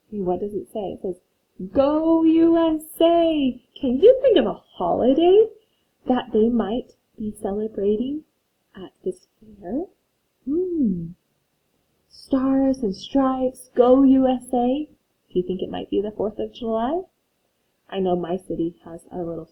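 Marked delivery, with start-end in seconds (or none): 1.7-3.6 high, loud cheering shout. Acted. Reading the flag's words to the class as a loud cheer. Cheering sign text in story. 12.1-14.9 drawn out. Shown as a demonstration. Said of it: "teacher hinting slowly".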